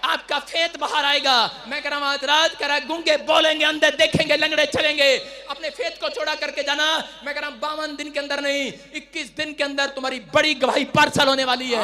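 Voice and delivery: loud, high, sustained sermon chant; rousing exhortation to the congregation; forceful preaching. Acted.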